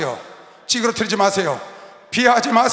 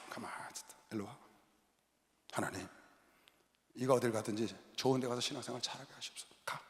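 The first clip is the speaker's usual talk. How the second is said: hushed prayer; 11 semitones lower, 18 dB quieter than usual. Retold or imitated.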